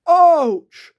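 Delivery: a creature hit by the falling basket; burbled ouch. Acted. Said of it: "burbled Guff".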